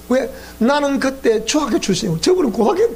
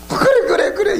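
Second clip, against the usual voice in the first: laughing mock agreement; 8 semitones higher than usual.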